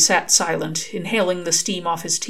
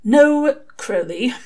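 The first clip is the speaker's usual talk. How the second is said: strained; 7 semitones higher, 4 dB louder, much slower than usual. Acted. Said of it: "he said in strained tones".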